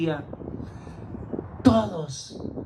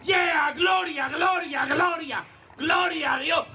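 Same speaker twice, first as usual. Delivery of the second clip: joyful shout; 8 semitones higher, slower than usual. Acted. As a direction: cry out glory as if leaping for joy. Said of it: "este tipo gritaba".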